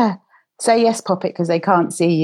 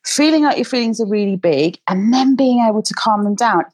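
normal talking voice

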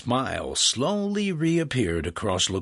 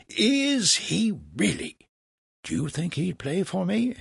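higher-pitched innocent asking; feigned wonder; 10 semitones higher than usual. A narrator's reading. Franklin scams Legras about Mozart, innocently wondering. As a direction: play naive to bait him. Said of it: "Franklin scammed".

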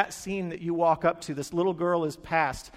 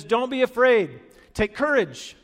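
loud, raised call of encouragement; higher, commanding comfort; calling out reassurance; 6 semitones higher, 6 dB louder than usual. Acted. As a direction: call firmly across the water. Jesus calls out to frightened disciples.

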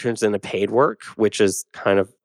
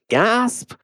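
mock gasp; 12 semitones higher than usual. Mock gasp in the middle of sentence.

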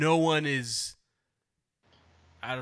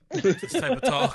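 mock disapproval; 12 semitones higher, 6 dB louder, slower than usual. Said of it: "I do not condone this".

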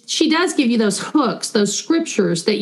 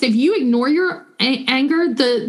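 normal talking voice

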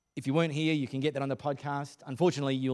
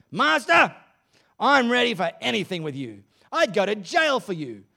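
high, loud, fervent vow building to a slow shouted finish; impassioned cry; 6 semitones higher, 8 dB louder than usual. Acted. Peter vows loyalty to Jesus, crying out loud and high. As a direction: pledge everything to the master at full cry. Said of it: "Exclamation mark".

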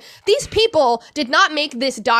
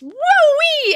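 much higher, louder and slow "woo-wee"; incredulous exclamation; 5 dB louder than usual. Real, not acted. Telling the host about the hateful DMs, an incredulous stretched "woo-wee". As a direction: stretch it out in disbelief.

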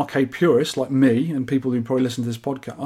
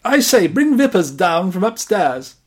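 slow, plummy lord of the manor; posh aristocrat voice; 5 semitones higher, 4 dB louder, slower than usual. Acted. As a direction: play a toff summoning his butler. Posh master calling for servant.

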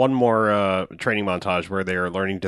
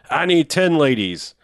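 higher, drawn-out mock demand; slow, raised-pitch imitation of a demand; 6 semitones higher, 3 dB louder, slower than usual. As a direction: imitate a villain stubbornly demanding, stretching the words. Quoting the villain's demand for women.

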